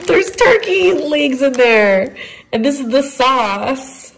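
high and slow, cooing; pet talk. Real, not acted. Owner showing her dog turkey treats, high slow coo.

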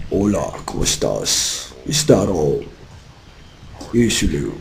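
deep, slow seductive voice; sexy voice. Pretend. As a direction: purr it low and sultry. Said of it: "tengo una voz toda sexy".

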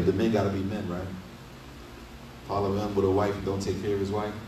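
ordinary delivery

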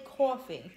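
"coffee" with a heavy "aw" first vowel; demonstrated regional pronunciation. Shown as a demonstration. Asking others to say coffee, drawn-out regional pronunciation. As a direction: draw out "coffee" as CAW-fee. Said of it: "you guys are entirely different".

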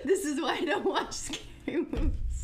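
words squeezed out through laughter; giggly, scared confession; nervous laughing. Real, not acted. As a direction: laugh helplessly while explaining the fear. Nervous laughing at own fear.

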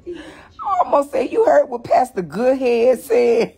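laughing through the words; cracking up at his own slip. Real, not acted. Laughing at his own slip of the pastor's name, laughing.

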